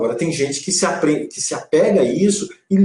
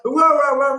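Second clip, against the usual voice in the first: put-on high wailing "uá, uá, uá" like a fussy child; hammed-up toddler wail; mock baby crying; 10 semitones higher, 4 dB louder, slower than usual. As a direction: imitate a child whining and crying. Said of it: "meio chorando assim".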